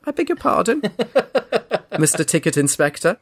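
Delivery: high, amused outrage with a laugh; mock-polite outrage breaking into a laugh; incredulous laughing disbelief. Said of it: "I said".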